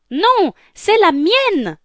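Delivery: high, drawn-out sisterly protest; squabbling contradiction. Acted. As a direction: fight for it like a spoiled sister. Younger sister quarrels over shoe. (dispute, voix aiguë et traînante)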